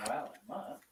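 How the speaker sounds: far off while closing door; talking away from the mic at the door; shutting the door. Real, not acted. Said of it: "let me shut the door".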